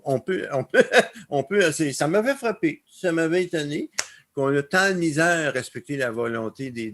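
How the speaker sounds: usual speaking voice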